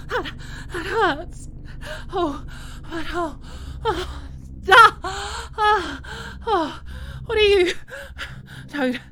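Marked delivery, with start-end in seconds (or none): 4.7-6.0 higher, louder startled cries; sharp cry. Acted. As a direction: cry out at sudden pain. Captive moans under the captor's touch.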